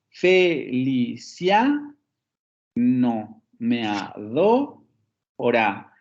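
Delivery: drawn-out "fe-li-CIA no-me-a-DO-ra"; stressed syllables marked. Shown as a demonstration. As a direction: scan syllable by syllable, lifting CIA and DO. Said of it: "el último acento aparece".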